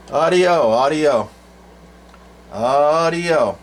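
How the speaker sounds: sing-song test call into a CB mic; drawn-out test call. Real, not acted. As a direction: stretch out each word into the mic. Keying mic for transmit test.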